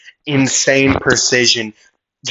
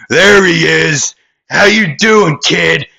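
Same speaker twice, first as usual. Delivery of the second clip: high, loud villain's hail; loud taunting greeting; 6 semitones higher, 5 dB louder than usual. Acted. Red Crosser spotting the hero, calling out loudly. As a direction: call out to the kid across the fight.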